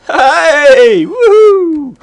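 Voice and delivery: loud high whoop of triumph; triumphant cheer. Real, not acted. Cheering success in the game.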